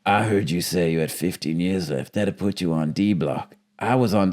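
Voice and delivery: a hint of a low, quiet convict voice; lightly voiced tough prisoner sizing Cage up; low murmured menace, lightly imitated; Nick Chinlund's drawl. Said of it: "he actually does talk like that".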